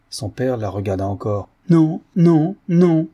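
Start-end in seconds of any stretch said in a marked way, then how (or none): 1.7-3.1 loud, high, separate blows; insistent raised-voice protest; emphatic refusal. A narrator's reading. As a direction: refuse flatly three times over. Daughter refuses the marriage repeatedly.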